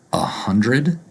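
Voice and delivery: "a hundred" with unstressed "uh", rising; reduced "a hundred". Shown as a demonstration. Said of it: "a more accurate pronunciation might be".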